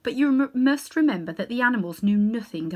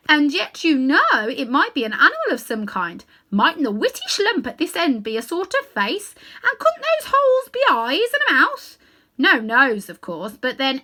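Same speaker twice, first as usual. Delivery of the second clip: haughty elephant lady, much higher and louder; proud she-elephant; 5 semitones higher, 6 dB louder than usual. Acted. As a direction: speak like a dignified elephant pleased with her trunk. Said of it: "with a pardonable pride".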